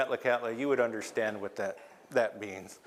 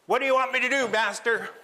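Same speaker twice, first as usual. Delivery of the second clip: much higher and louder, eager servant squeak; little eye creature; 10 semitones higher, 6 dB louder, faster than usual. Acted. Eye creature eagerly asks Raven for orders, high squeaky voice. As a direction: pipe up like a tiny eager minion.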